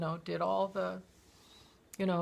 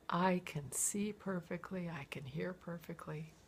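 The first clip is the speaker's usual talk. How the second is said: hushed confiding; 2 semitones lower, 9 dB quieter than usual. Retold or imitated. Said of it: "And she said".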